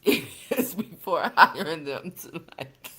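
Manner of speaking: ordinary talk, nothing marked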